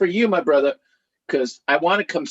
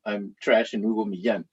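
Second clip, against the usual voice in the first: ordinary talk, nothing marked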